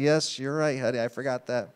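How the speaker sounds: low, softened, sheepish admission; meek concession. Acted. Husband conceding to his wife's reminder, meekly. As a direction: quietly give in, dropping the voice.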